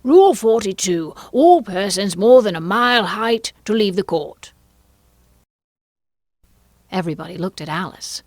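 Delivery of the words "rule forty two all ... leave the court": loud, raised royal proclamation; reading out a rule. A narrator's reading. King reads from his book to the court, loud proclamation. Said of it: "read out from his book".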